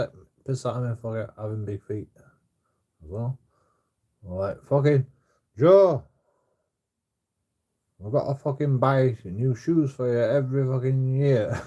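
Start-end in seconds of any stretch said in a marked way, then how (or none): 4.6-11.6 loud, high exasperated parent yelling at Joe; retold angry parent rant, loud and high; mock parent scolding. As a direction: shout it like a fed-up mum over shoe costs. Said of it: "what my mum and dad used to say".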